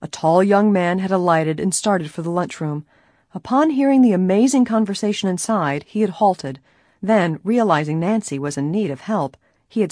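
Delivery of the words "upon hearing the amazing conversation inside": ordinary delivery, nothing marked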